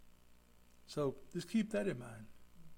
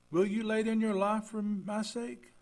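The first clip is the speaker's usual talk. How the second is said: higher, louder, slow question; scripture reading, raised; 6 semitones higher, 5 dB louder than usual. Acted. Pastor voicing Jesus questioning Peter, high and slow. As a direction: lift the line as Jesus' challenge.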